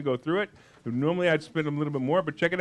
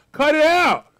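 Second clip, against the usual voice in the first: scolding shout; 9 semitones higher, 7 dB louder, slower than usual. Acted. Scolding self-talk.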